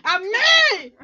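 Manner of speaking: high, loud cry of outrage; indignant yell. Real, not acted. Player accusing friend of daring to kill him, indignant yell.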